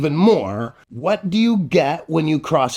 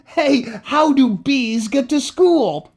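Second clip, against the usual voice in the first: ordinary delivery, nothing marked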